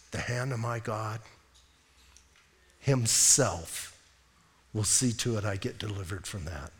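low, soft, with weighty pauses; solemn resolve. Acted. Pastor showing believers how to answer doubt, solemn resolve. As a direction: say it quietly, phrase by phrase.

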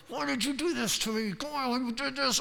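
high, hushed imitation of screaming; mock labor screaming. Woman in labor blaming her partner and God, mock screaming. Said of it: "And they're screaming".

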